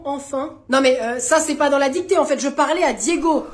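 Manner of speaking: ordinary delivery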